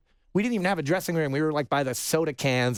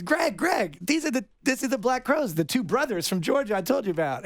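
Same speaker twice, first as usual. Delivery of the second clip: a lightly voiced Warren, higher and eager; Warren calling out; 7 semitones higher than usual. Warren calls Greg over to meet the band, lightly voiced and eager. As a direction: call Greg over, pitched up and keen.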